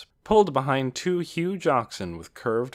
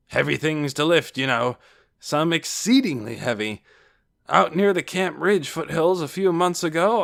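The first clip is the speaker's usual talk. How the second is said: loud, raised storyteller's brag; boastful Casper; 6 semitones higher, 6 dB louder than usual. A narrator's reading. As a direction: hold forth heartily about feats of strength. Casper boasts of his strength.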